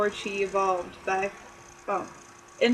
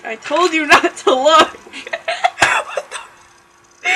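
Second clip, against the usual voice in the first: loud laughing words; 5 semitones higher, 7 dB louder than usual. Real, not acted. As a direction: shout through giggles. Laughing while reading.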